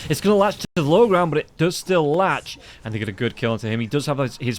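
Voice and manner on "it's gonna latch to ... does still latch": rising excited cast call; play-by-play. Acted. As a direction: call the fight, building up.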